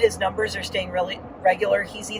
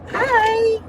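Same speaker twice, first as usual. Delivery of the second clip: sweet high greeting. Retold or imitated. Greeting husband all smiley.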